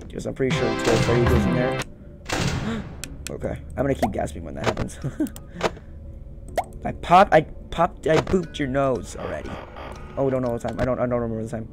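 normal talking voice

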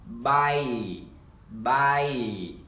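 "buy" stretched on a long ai; long I demo. Shown as a demonstration. Teacher models long I for learners, drawn-out buy. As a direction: hold the vowel of each "buy". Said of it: "âm long I và chúng ta đọc là".

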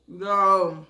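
talking between bites; chewing. Real, not acted. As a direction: mouth partly full. Seen 1: Forkful eaten at -5; closed-mouth chewing continues between words throughout.

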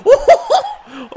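loud, high hooting laugh. Real, not acted. Gamer after getting nicked, high hooting laugh. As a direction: burst out in high hoots.

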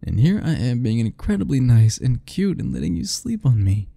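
normal talking voice

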